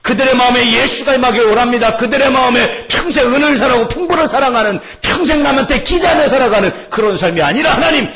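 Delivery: shouted, high-pitched intercession; fervent prayer. Real, not acted. Preacher praying for angry people's hearts, fervent shout. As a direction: cry out to God at full force. Prays aloud at full force.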